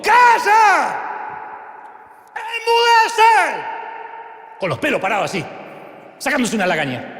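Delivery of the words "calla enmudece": high, loud, stretched rebuking shout. Acted. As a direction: roar it at the storm. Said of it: "increpó y gritó". (grito fuerte, agudo y alargado)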